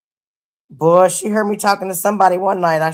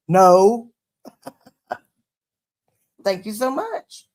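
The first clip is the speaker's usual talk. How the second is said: imitating friend; 3 semitones higher, slower than usual. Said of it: "And he's like".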